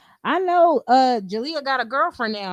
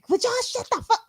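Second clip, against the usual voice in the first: retold angry snap; 10 semitones higher, faster than usual. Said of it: "She was hot".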